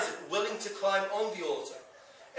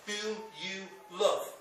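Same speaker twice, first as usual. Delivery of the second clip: slow emphasis; slower than usual. Real, not acted. Stressing God's painful command.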